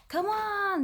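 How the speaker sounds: ordinary talk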